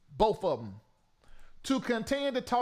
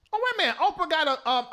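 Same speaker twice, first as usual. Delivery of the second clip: normal talking voice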